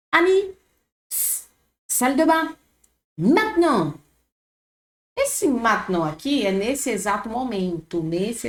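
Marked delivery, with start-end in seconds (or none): 0.1-3.9 higher and louder, urgent hushed call; teacher voicing a character calling out, pitched up; acted call. Teacher acting a character calling Annie, higher and louder.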